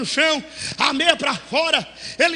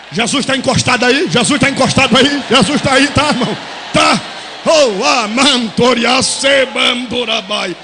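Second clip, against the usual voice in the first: fiery preaching; 2 semitones lower, 8 dB louder than usual. Acted. Pastor asks if Jesus is present, loud preaching into tongues. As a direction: shout it, then drop into tongues.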